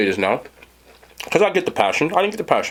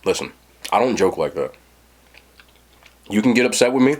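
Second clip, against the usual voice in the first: calmly warning a new partner about toxic games; 9 semitones lower than usual. Retold or imitated. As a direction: lay down the rule gently but firmly. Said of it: "very calmly".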